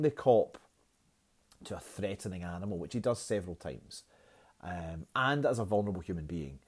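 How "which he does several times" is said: normal talking voice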